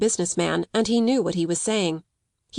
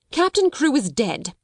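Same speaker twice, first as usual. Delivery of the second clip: higher, curt delivery of bad news; blunt announcement; 6 semitones higher than usual. A narrator's reading. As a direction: break the news without softening it. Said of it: "Miss Minchin wasted no words".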